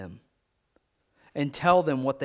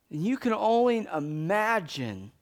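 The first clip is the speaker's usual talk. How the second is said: ordinary delivery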